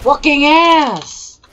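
high, slow, stretched-out taunt; mocking drawn-out insult. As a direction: stretch every syllable to mock.

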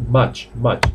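"much" repeated as "match"; pronounced with A. Shown as a demonstration. Teacher repeats the correct sound, "much" with an a. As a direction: say "much" twice with an open a. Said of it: "se pronuncia con A".

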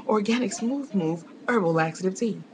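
gasping TV ad pitch; mock infomercial. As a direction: hype it like a cheesy ad. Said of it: "That sounded like a bad infomercial".

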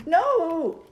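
high loud shout of protest; yelling. Real, not acted. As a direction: yell no in dismay. Taken off by killer.

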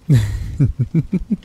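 amused laugh. Real, not acted. Teasing partner about shower.

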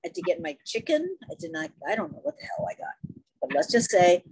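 usual speaking voice

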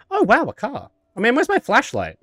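high, chirpy unfazed delight; mock-happy socially awkward guy; cheerfully oblivious Alan. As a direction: act thrilled by every disaster. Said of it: "I am now gonna also be Alan".

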